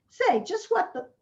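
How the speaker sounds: sudden high indignant challenge. Acted. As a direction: cut in, affronted.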